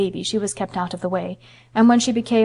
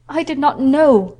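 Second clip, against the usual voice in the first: higher, louder and slow; distressed cry; 5 semitones higher, 5 dB louder, slower than usual. Acted. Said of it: "the Mem Sahib cried".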